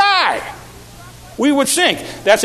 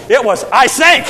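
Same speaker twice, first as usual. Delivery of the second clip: blurted out louder; louder, abrupt; sudden loud; 3 semitones higher, 4 dB louder than usual. Retold or imitated. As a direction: snap it out like a sudden drop. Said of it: "wasn't real slowly".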